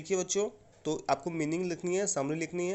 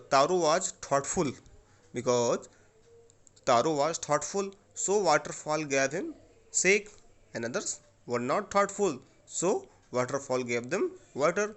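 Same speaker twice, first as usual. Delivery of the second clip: slow, louder answer dictated phrase by phrase; slow dictation; 5 dB louder, much slower than usual. Shown as a demonstration. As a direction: dictate slowly and clearly for the class to copy. (धीमे और ज़ोर से लिखवाते हुए)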